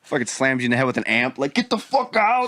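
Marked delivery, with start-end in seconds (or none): 1.5-2.5 high-pitched, hammed-up shout; mock furious yell. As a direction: yell it while pretending to attack. Acting man slamming you with amp.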